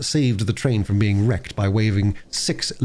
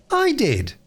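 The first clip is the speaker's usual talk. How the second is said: put-on little girl yelling her claim; mock scream. Girl claiming credit from her brother, shrill and high. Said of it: "screamed Phyllis".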